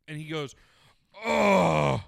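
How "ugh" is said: loud, long mock groan; exaggerated bathroom grunt; imitated straining groan. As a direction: groan it out like heavy relief. Imitating stranger straining at the urinal.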